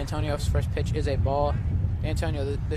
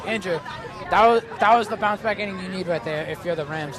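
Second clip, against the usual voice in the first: excited play-by-play; 6 semitones higher, 8 dB louder than usual. Real, not acted. Exciting double play call.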